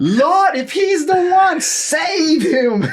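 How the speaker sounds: high, loud fervent pleading to the Lord; wife praying with fire, high and loud; fired-up prayer. Retold or imitated.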